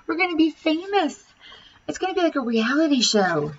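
put-on high, excited girlish voice; freaking out girls. A narrator's reading. Girls freak out about fame, high and excited. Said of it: "girls started screaming and freaking out".

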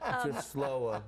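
a touch of drawled New Orleans, R dropped; lazy New Orleans accent. Shown as a demonstration. Comic explaining his hometown to hosts, lazy New Orleans accent. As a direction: lightly imitate a lazy New York-style New Orleans accent. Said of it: "it's like a New York accent".

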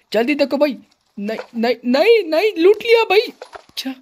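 rising frantic yell, high and loud; real alarm, voice shooting up; panicked shouting. Real, not acted. Rival loots room first, panic.

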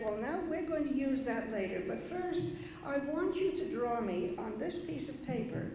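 normal talking voice

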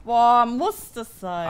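annoyed. Real, not acted. Genervt über Rülpsen.

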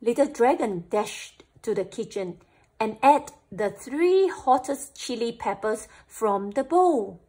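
plain reading voice

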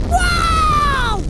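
long, high, loud cry; startled yell. Real, not acted. Player startled at the generator, yelling. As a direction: holler in shock, sliding down at the end.

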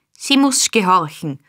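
plain reading voice

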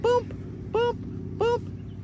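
high popping booms; high sound effect. Shown as a demonstration. Trainer showing hand motions with booms, high pitched. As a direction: pop each boom up high. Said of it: "Left hand, right hand".

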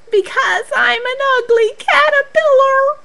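high, tearful little caterpillar voice; crying Katy. Acted. Katy tells Charlie she's ugly, in acted tears. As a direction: sob it out in mock misery. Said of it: "cried Katy".

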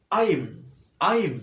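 modelling "আইম" for students; I'm pronounced. Shown as a demonstration. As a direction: say "আইম" as one clipped syllable, no gap. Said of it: "কি হবে এখানে উচ্চারণটা?".

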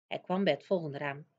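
usual speaking voice

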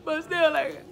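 high tearful whine, acted; worked up, emotional. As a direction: imitate giving in while still whimpering. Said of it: "all worked up, all emotional".

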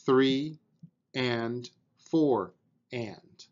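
slow counted beats with pauses between; counting out eighth notes. Shown as a demonstration. Drum teacher counting eighth notes for a student, steady and spaced. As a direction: count it out evenly, beat by beat. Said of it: "you would count those like this".

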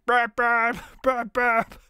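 playful mouth-made ringing; mock phone ring. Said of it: "telephone rings".

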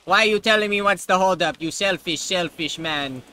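indignant yell. Real, not acted. Gamer yelling back at a character in the well, indignant yell. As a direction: snap back at the rude character.

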